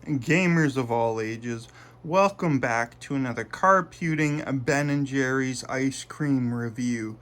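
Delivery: slow, drawn-out host greeting; showman intro. Acted. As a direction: welcome the gamers like a grand announcer. Channel intro greeting to gamers.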